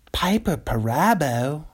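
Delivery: lightly voiced slow announcer reading of a name; a hint of a slow, drawn-out critic voice; Dave Damashek impression. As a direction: savour the name like a film critic host. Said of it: "this is my Dave Damashek".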